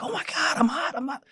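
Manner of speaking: high, mock panicked cry; retold panic of a burning man. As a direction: squeal it like he's burning. Man burning behind locked door.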